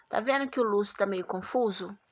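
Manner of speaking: usual speaking voice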